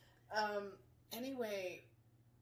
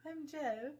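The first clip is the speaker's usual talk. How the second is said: put-on English accent; 6 semitones higher, 4 dB louder than usual. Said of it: "in his English accent".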